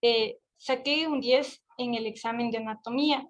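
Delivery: ordinary talk, nothing marked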